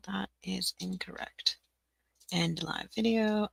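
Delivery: normal talking voice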